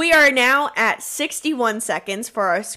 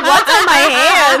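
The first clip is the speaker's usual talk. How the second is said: loud high mock question; 3 semitones higher, 8 dB louder than usual. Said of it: "She's like".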